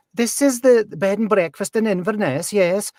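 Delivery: high-pitched Highland Scottish sing-song; sing-songy Highland accent. Retold or imitated. Bed and breakfast host confirming to a caller, sing-songy Highland accent. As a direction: lilt it like an Inverness innkeeper. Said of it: "so sing-songy".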